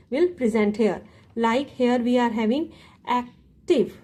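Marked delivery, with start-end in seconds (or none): 3.1-4.0 "active" broken into two parts, ac, tive; split syllables. Shown as a demonstration. Teacher showing the class two syllables, "active" split in two. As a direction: say "active" with a gap between its syllables. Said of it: "two syllable are presenting here".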